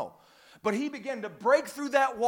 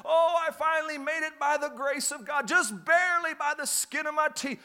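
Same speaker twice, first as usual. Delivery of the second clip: higher, worn-out relief; weary straggler; 8 semitones higher than usual. Pretend. A ragged churchgoer barely making it, higher mock relief. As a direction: gasp it like someone who barely made it. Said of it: "come dragging in just barely".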